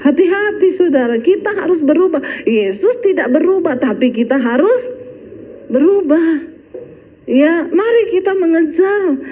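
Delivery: high, urgent sermon voice; fiery preaching. Acted. Preacher urges the congregation to change, fiery preaching. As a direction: exhort the church to change.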